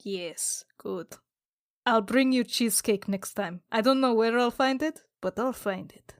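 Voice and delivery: ordinary talk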